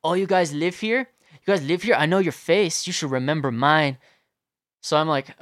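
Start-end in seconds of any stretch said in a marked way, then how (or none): 0.0-4.0 raised, pitched-up mock menace at first; threatening. Litterer intimidating the residents at their home, threatening. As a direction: imitate a man trying to intimidate, loud and insistent. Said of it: "starts threatening us".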